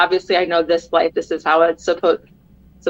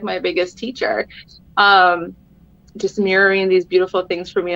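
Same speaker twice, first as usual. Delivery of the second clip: ordinary delivery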